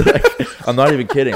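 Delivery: laughing through the words. Real, not acted. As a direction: giggle out the line, real laugh. Laughing while retelling the theft.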